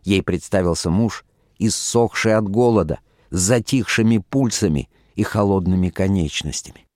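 ordinary delivery, nothing marked

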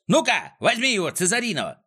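high, eager yell to a dog; urgent high-pitched urging; yelled command. A narrator's reading. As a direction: set the dog on with a holler. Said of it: "заорал Клопс".

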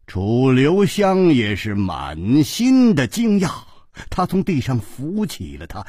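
plain reading voice

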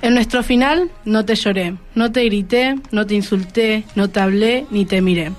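ordinary talk, nothing marked

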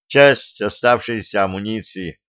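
usual speaking voice

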